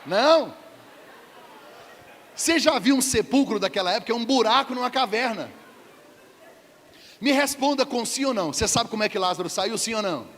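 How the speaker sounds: preaching at full force; fiery preaching. Real, not acted. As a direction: press the crowd hard. Preacher pressing the congregation.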